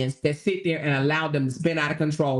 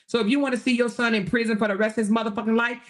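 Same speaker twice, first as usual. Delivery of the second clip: ordinary talk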